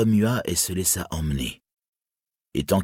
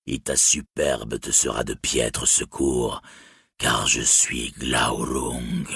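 low, slow, proud dragon; 6 semitones lower, slower than usual. Acted. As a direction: drawl it out like a gloating dragon. Dragon Glaurung's voice held.

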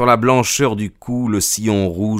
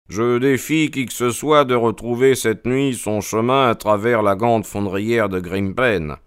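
lightly voiced confident detective; a touch of defiance; challenging Holmes; 2 semitones higher than usual. A narrator's reading. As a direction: state it firmly, as the detective.

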